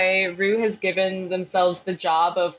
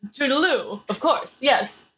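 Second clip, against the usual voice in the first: high, chirpy genteel goodbye; prim farewell voice; 6 semitones higher than usual. Acted. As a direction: trill a polite goodbye. In-character farewell.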